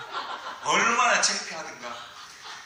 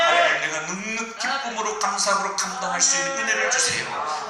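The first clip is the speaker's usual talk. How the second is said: building prayer; 5 semitones higher, 5 dB louder than usual. Acted. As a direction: pray it out, swelling to a shout.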